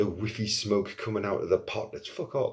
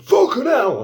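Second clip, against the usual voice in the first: startled yell, much higher and louder; shocked shout; 11 dB louder than usual. Retold or imitated. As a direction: shout it as the blast goes off. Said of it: "everyone's like".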